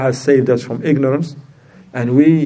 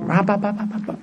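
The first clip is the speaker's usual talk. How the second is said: mock ranting babble; 6 semitones higher, 7 dB quieter, faster than usual.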